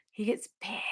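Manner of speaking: unvoiced whisper. Real, not acted. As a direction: whisper it as an aside. Aside about Bill.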